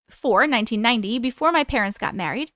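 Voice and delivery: ordinary delivery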